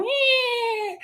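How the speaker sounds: very high, thin, drawn-out squeal; soft comic high-pitched squeak; imitated boots noise. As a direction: mimic boots curling up with a high whine. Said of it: "all you see was the little boots and go".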